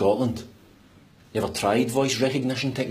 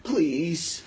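higher, rising, reluctant "Please"; grudging plea; 6 semitones higher than usual. Acted. Passenger giving in to the lift, grudging plea. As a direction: beg against your will, pitch lifting.

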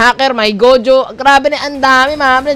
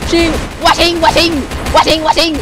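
battle cry; 5 semitones higher than usual. Pretend. Sword battle cries.

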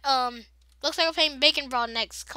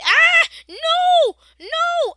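much higher, much louder scream of no; 12 dB louder, much slower than usual. Real, not acted. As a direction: scream in real panic. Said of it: "If I'm yelling too much".

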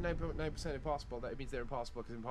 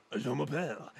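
put-on French voice; funny French accent. Shown as a demonstration. Friend arguing for funny voices, hammed French accent. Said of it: "couldn't do the funny voice".

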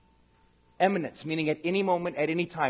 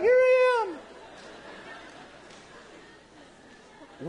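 rapturous calling out. Acted. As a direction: call up to heaven in comic ecstasy. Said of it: "and he's like".

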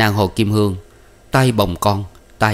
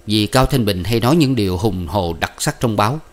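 ordinary delivery, nothing marked

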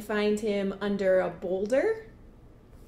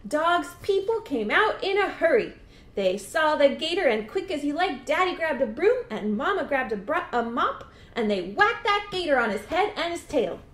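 higher, bouncy climax telling; animated storytelling at a raised pitch; excited story narration; 6 semitones higher, 4 dB louder than usual. A narrator's reading. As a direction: rush the action along with glee.

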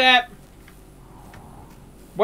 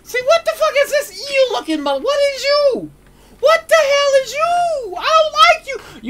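high, shouting and laughing in disgust; freaked-out yell; 13 semitones higher than usual. Real, not acted. Gamer shrieking in disgust at an eel monster on screen. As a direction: shriek at the ugly thing, half laughing.

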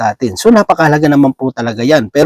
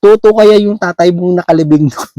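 lightly voiced mother, a bit higher; mock mother asking her child, pitched up; 4 semitones higher than usual. Mother asking her child to check the coffin, higher mock voice. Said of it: "sabi ng nanay dun sa anak".

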